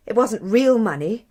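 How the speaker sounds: usual speaking voice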